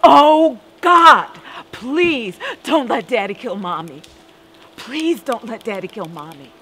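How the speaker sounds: put-on desperate child's sobbing plea, loud at first; a little girl crying out to God. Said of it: "I screamed and cried".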